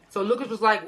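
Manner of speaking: usual speaking voice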